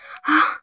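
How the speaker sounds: startled gasp; short frightened gasp; sharp gasp. Real, not acted. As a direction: gasp in shock. Gasp at the find.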